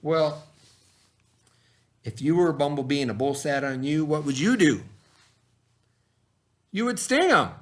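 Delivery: put-on lively picture-book narration for young listeners; reading to children. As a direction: tell it like bedtime for a class of kids. Reads picture book to kids.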